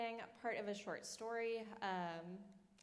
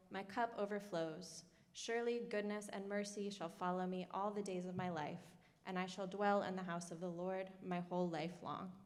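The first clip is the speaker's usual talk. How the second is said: steady, measured scripture reading; psalm reading; 5 dB louder than usual. Acted. Author reads Psalm twenty-three to the audience, solemn. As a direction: read the psalm as a solemn preface.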